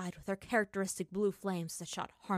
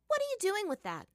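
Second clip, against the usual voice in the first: much higher curious question; 13 semitones higher, 3 dB louder than usual. Acted. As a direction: ask with eager curiosity. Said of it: "Azula asked curiously".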